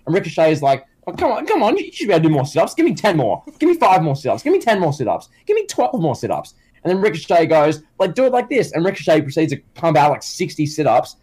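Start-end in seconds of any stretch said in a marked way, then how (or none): 1.1-6.5 high-pitched mock pep talk; pushy trainer. Trainer badgering his client for more sit-ups, pushy. 8.1-8.7 lightly voiced show-off trainer, higher pitch; mock eager trainer. Trainer showing his client sit-ups, mock eager.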